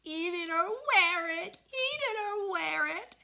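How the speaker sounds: put-on high, loud toddler shriek; toddler scream. Toddler screaming at his father at supper. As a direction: scream it like a furious small child. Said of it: "Fudge screamed".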